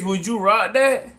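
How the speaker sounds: normal talking voice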